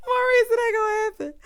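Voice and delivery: high, loud, laughing words; laughing refusal. Real, not acted. Telling Maurice no, high laughing shout. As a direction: squeal it out through laughter.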